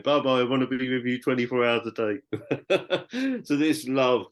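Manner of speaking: laughing through the words; half-laughing, giddy with love; giggly eager plea, giggling throughout. Retold or imitated. Eager message to Baba.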